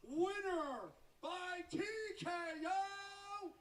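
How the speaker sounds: drawn-out booming winner call; boxing ring announcer. Acted. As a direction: stretch the name out to the crowd. Announcer reads the result.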